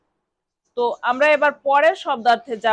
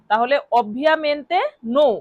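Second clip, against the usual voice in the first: usual speaking voice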